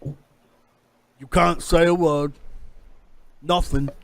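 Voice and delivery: deliberate captain's warning, lightly in character; pirate captain threatening. Acted. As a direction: press for agreement as a pirate captain. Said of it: "he looks at you and he says".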